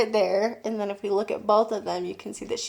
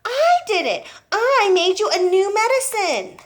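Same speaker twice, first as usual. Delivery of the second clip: put-on insistent boy; 11 semitones higher, 6 dB louder than usual.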